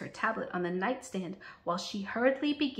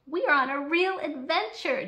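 put-on higher, louder child's voice; chiming in; 7 semitones higher, 6 dB louder than usual. A narrator's reading. Jade chiming in on the flight, eager high voice. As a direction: join in eagerly as another kid. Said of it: "Jade cl-, chimes in".

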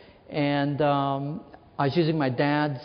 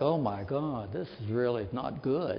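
much softer, lower, half to himself; a scared little aside in the dark; hushed dread; 5 semitones lower, 9 dB quieter than usual. Retold or imitated. Boy in the coal mine, muttering his dread under his breath.

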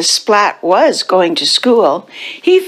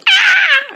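high mock scream. A narrator's reading. Cats frightened by Seymour's face, high mock scream. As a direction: give a contained, high-pitched scream. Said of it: "they screamed".

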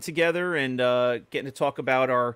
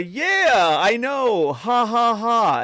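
awkward fake laugh; 8 semitones higher, 6 dB louder, much slower than usual. Stranger laughing it off.

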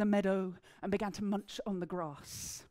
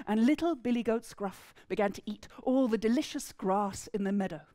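plain reading voice

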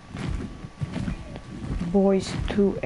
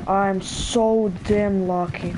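ordinary talk

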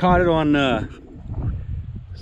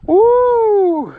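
excited whoop; 9 dB louder than usual. Real, not acted. Celebrating the bass catch.

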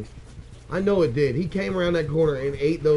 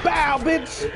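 high shouted taunt; triumphant game taunt; 10 semitones higher than usual. Real, not acted. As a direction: yell it at the enemy.

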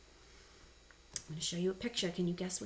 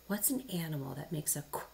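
ordinary talk

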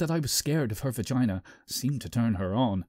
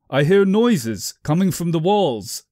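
raised, insistent complaint; 4 semitones higher, 6 dB louder than usual. Acted. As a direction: press the point louder. Narrator voices himself insisting.

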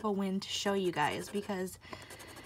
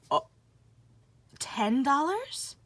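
slow, louder rising surprise; surprised; 4 semitones higher, 8 dB louder, slower than usual. Real, not acted. Revealing the prize under the first seven, amazed. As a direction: drag it out, amazed.

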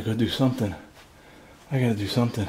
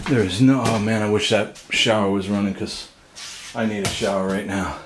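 ordinary talk, nothing marked

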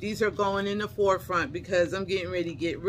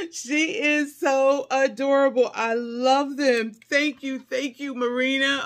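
raised, loud, emphatic delight; excited gushing; 5 semitones higher, 5 dB louder than usual. Real, not acted. Thanking the sender for the glasses, excited gushing. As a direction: gush with heartfelt thanks.

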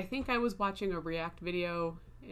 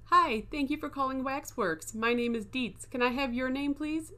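higher, bright call center greeting; sing-song customer service voice; cheery phone voice; 6 semitones higher than usual. Retold or imitated. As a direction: chirp it like a recorded intro. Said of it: "Imagine sitting next to me and hearing".

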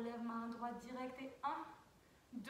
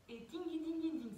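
higher bright dings marking the beat; quick ding sounds; 5 semitones higher than usual. Shown as a demonstration. Teacher sounding the hand accents for the class, quick dings. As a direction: tap out each ding like a bell. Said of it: "C'est très rapide".

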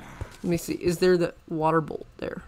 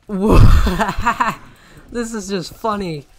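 laughing; 3 semitones higher, 12 dB louder, much slower than usual. Real, not acted. Dies to mobs, bursts laughing.

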